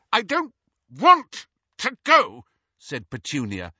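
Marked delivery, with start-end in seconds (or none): 0.1-2.4 high, loud resentful snap; bitter retort. Acted. Petunia pulls away from her sister, high and loud. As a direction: throw it back at her sister.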